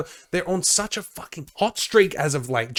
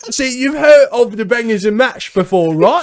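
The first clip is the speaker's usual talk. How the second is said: loud, high hammed-up Australian accent; mock Australian accent; 7 semitones higher, 9 dB louder than usual. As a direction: play a rowdy Aussie bloke, loud and high. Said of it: "we are in Australia".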